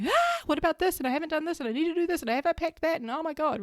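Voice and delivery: high, frantic inner voice; anxious brain voice. Retold or imitated. Her anxious brain listing tasks before the trip, high and frantic. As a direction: play the panicking brain in a high pitch. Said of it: "my brain was like".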